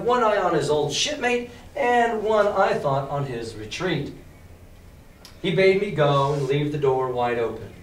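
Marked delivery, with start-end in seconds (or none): none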